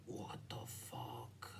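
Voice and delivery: low slow breathy whisper of disbelief; baffled whisper. Real, not acted. Alone at his desk, baffled by the app, whispering. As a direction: mutter it under your breath, stunned.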